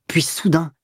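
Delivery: ordinary delivery, nothing marked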